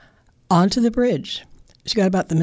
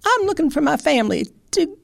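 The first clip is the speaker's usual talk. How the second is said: very high, squeaky, quick squirrel; 11 semitones higher than usual. Acted. Hungry squirrel looking for his biscuits, squeaky and high. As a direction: chatter like an impatient squirrel. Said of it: "Squirrel said".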